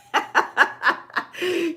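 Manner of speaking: big high burst of laughter; high loud laugh. Real, not acted. Loud laugh while watching.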